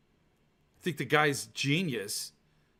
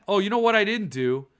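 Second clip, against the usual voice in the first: ordinary talk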